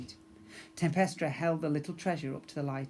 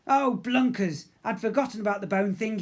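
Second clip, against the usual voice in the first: dismayed captain; 6 semitones higher, 7 dB louder than usual. Acted.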